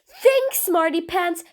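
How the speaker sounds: drawn-out, exasperated prodding. Acted. Villain prods the clever prisoner to work it out, exasperated. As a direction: stretch it out slowly, losing patience.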